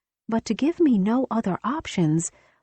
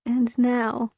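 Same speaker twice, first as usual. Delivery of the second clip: usual speaking voice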